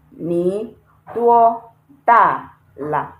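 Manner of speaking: Ni duo da la drawn out syllable by syllable; teacher slowly reading the finished question; slow model sentence. Shown as a demonstration. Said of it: "question mark here".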